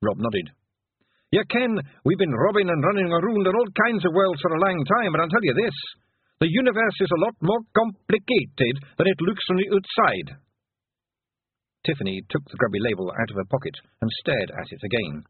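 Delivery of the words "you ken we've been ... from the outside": higher Scots pixie voice; broad Scottish accent. Acted. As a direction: Rob Anybody's Scottish brogue. Rob speaks.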